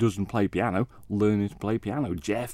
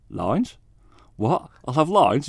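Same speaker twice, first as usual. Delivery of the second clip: startled questions rising higher and louder; mock disbelief, building to a louder, higher query; retold alarm; 7 semitones higher, 6 dB louder, much slower than usual. Bassist retelling his shock at learning of lines, alarmed disbelief.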